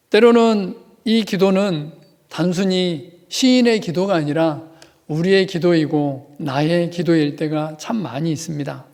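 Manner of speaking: ordinary talk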